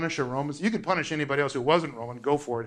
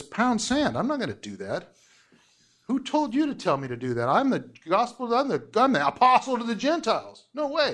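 a hint of an indignant Paul, pitched higher; mildly hammed-up refusal, higher; defiant Paul; 8 semitones higher than usual. Paul refusing the elders, lightly voiced as defiant. Paul's defiant retort, acted out.

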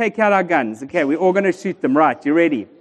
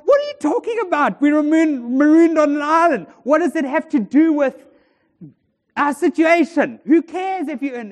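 high-pitched incredulous protest; worked-up, high and disbelieving; acted exasperation; 6 semitones higher than usual. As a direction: play a frantic castaway who can't believe his friend. Acted panicked castaway challenging calm friend.

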